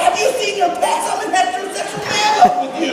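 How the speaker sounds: giddy high-pitched fan; freaking out. Retold or imitated. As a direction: blurt it out star-struck. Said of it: "I'm like".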